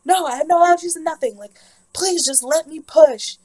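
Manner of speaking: high, loud retold outburst; exasperated yelling. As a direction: yell back, fed up and desperate. Acted fed-up yelling back at staff.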